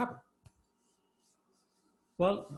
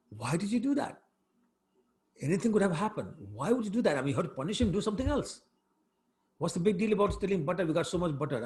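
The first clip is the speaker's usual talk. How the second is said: high-pitched, indignant scolding; mock-angry outburst, pitched up and pressing on; retold angry yelling; 6 semitones higher, faster than usual. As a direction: act out an indignant telling-off. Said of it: "He was literally yelling at his".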